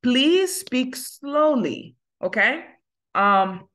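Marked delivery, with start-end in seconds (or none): 0.0-2.0 very slow, each word stretched; slow model phrase. Shown as a demonstration. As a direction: drag it out for the class to copy. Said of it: "Pale dousman, silvouplè".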